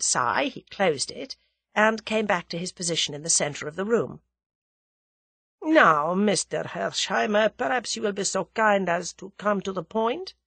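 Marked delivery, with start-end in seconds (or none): 5.6-10.3 formal Russian, measured and courteous; put-on Russian voice, politely pressing; suave Russian dignitary. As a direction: invite him politely, as a Russian grandee.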